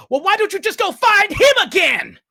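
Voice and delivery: very loud, high, all-out yell; screamed, bitter outburst; furious shout. Acted. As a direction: shout it across the street in fury. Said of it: "you hear a voice shout out".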